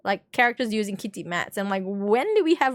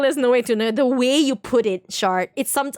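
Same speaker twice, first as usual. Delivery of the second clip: usual speaking voice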